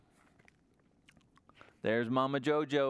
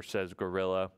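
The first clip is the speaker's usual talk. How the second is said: usual speaking voice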